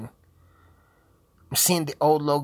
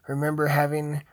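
ordinary talk, nothing marked

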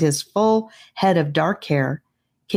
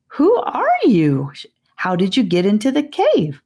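put-on puzzled girl; 5 semitones higher than usual. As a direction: ask the strangers, baffled and a little wary. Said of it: "looking confused".

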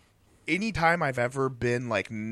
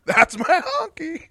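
much higher, slow, drawn-out "That's my honky"; slow high catchphrase; 6 dB louder, slower than usual. Pretend. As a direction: stretch every word up high, laughing. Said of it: "That's gonna be my catchphrase".

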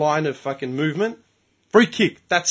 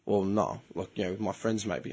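sheepish mate; 8 semitones lower, 10 dB quieter than usual. Retold or imitated. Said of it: "You grew up playing soccer?".

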